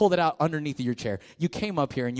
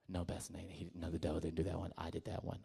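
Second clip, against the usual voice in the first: put-on low, much softer girl's voice; imitating teen girl; 10 semitones lower, 17 dB quieter than usual. Pastor imitating a girl blaming the devil, softly. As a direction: hush it like an embarrassed teen. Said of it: "She's like".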